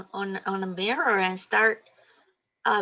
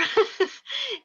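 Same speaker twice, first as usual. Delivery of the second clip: laugh. Real, not acted. Laughs mid-sentence.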